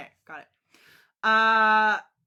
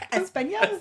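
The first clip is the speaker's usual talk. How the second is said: mock Spanish; 12 semitones higher than usual. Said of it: "because I'm a Spaniard".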